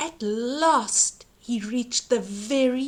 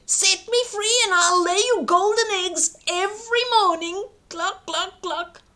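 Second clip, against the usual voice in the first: high clucking hen bargaining; 8 semitones higher, 4 dB louder than usual. Acted. Golden hen bargaining with Jack, high clucking.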